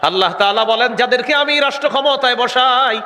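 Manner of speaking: rising into high sermon pitch; impassioned preaching. Real, not acted. Preacher explaining the verse to the congregation, rising fervour.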